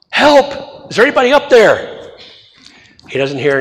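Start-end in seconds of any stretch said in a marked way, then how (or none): 0.1-2.1 mock yell for help. Man hanging from a branch calls upward, mock frightened yell. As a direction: call up the cliff like a scared man hanging on. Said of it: "He, he yells up".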